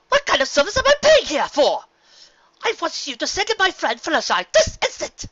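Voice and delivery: high, loud demanding outrage; Rarity scolding the spa pony, high and loud; indignant shout. A narrator's reading. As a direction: tell off the staff. Rarity berating silent spa pony.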